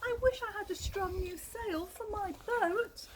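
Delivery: high, plaintive little goat. Acted. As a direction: sound like a goat wishing for a new sail. Goat tells its troubles.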